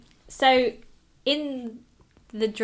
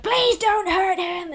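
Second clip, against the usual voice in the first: slow, high, louder plea; pleading; 10 semitones higher, 9 dB louder, slower than usual. Retold or imitated. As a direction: beg them to spare him. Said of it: "he's just like".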